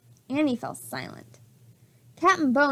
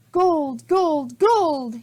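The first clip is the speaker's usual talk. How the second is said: gloating chant, higher and louder; greedy cry; 3 semitones higher, 4 dB louder, much slower than usual. A narrator's reading. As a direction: relish the treasure. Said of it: "he cried".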